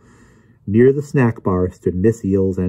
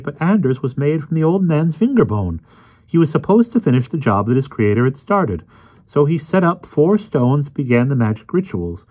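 higher-pitched, self-assured lecturer; Emerson's know-it-all voice; 7 semitones higher than usual. Acted. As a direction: explain it all with smug certainty. Voices Emerson explaining smugly.

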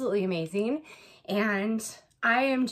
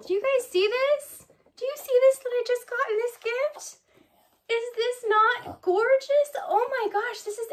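much higher, thrilled asking viewers; excited, showing off; 11 semitones higher than usual. Real, not acted. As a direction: show it off, bursting with delight.

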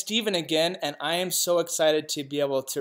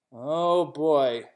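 ordinary delivery

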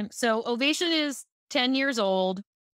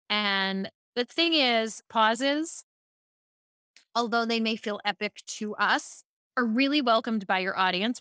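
usual speaking voice

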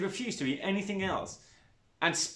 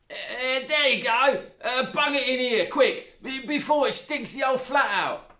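high, loud stepmother voice; squeamish, careless stepmother; coarse stepmother; 9 semitones higher, 5 dB louder than usual. A narrator's reading.

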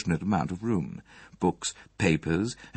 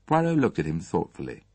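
usual speaking voice

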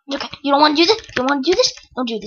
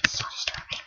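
breathy whisper, much softer; 18 dB quieter than usual. Real, not acted. Gamer reacting to the creepy squid, whispered.

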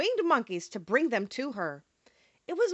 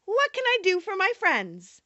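high, squeaky mouse queen; squeaky little voice; 8 semitones higher than usual. A narrator's reading. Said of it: "asked in a squeaky little voice".

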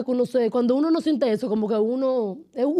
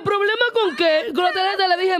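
laughing disbelief; 6 semitones higher than usual. Retold or imitated. Retelling the date's card problem, laughing.

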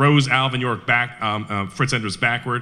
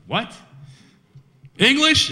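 baffled retort; 13 semitones higher, slower than usual. Retold or imitated. York answering the German officer, high incredulous retort. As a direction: throw the question back in disbelief.

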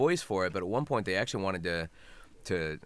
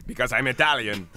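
loud, raised mock-Italian accent; 5 semitones higher, 9 dB louder than usual. Italian character justifying his pizza order, Italian accent. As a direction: declare it in a hammed-up Italian accent. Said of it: "what kind of a full-blooded Italian would call".

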